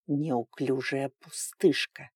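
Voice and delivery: low, slow, quiet dragon hiss; hissing reptile growl. A narrator's reading. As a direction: spit the insult like a dragon. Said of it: "прошипел дракон".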